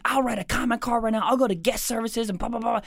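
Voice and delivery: high-pitched retold huffiness; mock indignant passenger. Comedian voicing supportive passenger threatening comment card, mock indignant.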